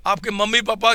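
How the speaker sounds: ordinary talk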